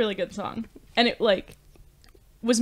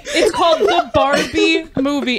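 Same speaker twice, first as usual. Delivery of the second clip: loud, emphatic teasing rebuke; high-energy, amused scolding; laughing protest; 4 semitones higher, 7 dB louder than usual. Real, not acted. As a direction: hammer it home loudly through laughter. Teasing rebuke of male friend.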